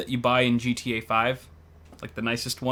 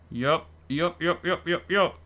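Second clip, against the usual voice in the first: silly voice; 3 semitones higher, slower than usual. Acted. Rapid playful yups.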